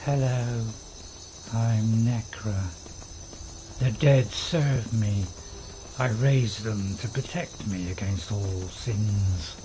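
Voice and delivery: low, slow, measured character voice; Necro. Shown as a demonstration. Streamer showing viewers his game character's voice, low slow necromancer. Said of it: "when I do Necro's voice, it's like".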